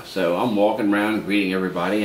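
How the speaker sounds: ordinary talk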